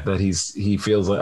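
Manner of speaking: ordinary talk, nothing marked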